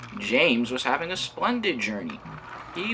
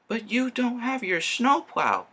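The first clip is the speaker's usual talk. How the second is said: higher, slow warning call; high whistled warning; 13 semitones higher than usual. Acted. Thomas warning James about the snowplow, high and slow. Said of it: "whistled Thomas".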